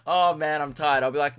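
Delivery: normal talking voice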